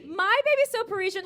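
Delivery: loud, high projected joke setup; call-and-response setup. Acted. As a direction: launch the punchline high and loud. Repeats the crowd call-and-response line.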